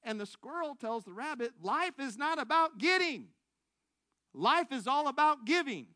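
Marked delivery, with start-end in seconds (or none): 1.6-5.9 loud, raised declaration of a moral; emphatic preaching. Acted. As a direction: proclaim it high and loud to the congregation. Preacher delivers the story's moral.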